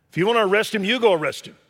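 loud, high, fervent preaching; preaching at full force. Acted. Preacher pressing his flock with full force. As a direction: thunder it out from the pulpit.

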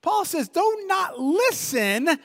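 high, slow "no, no, no"; emphatic Paul. Retold or imitated. Paul reassuring the worried Philippians, high and slow. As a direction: firmly wave off their fear.

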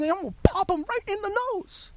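very high, strained mock threat; acted anger. Worker vowing to hit his boss, high hammed-up anger. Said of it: "Angry and mad".

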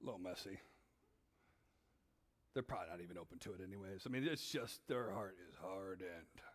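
low, quiet judging voice; judgmental inner voice. Retold or imitated. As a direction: drop low and dismiss them. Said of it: "I go".

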